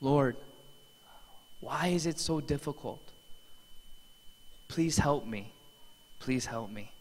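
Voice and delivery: a mild echo of mom's broken prayer in the night; mother praying alone at night, softly, near tears; tearful prayer. Retold or imitated. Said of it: "She was crying".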